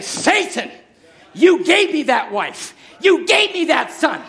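high, loud, forceful rebuke; rebuking shout. Acted. Believer to Satan, shouted rebuke. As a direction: cast him out, full voice.

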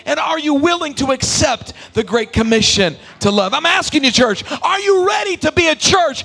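high, loud, drawn-out sermon shout; full-force preaching. Real, not acted. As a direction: preach it hard, punching each phrase to the congregation.

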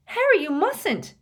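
high, loud alarmed shriek; panicked, pitched far up. A narrator's reading. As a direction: shriek a horrified plea to stop. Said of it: "shrieked Hermione".

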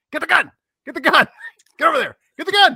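high, loud repeated yells; mock shouting. Imitating Frisco Kid hero, mock shouting. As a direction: hammer the shouts. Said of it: "The most shouting he ever does".

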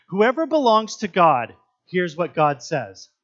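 slow, weighty proclamation; reading the verse with gravity; slow scripture reading. Acted. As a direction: let each word land slowly. Reads scripture aloud.

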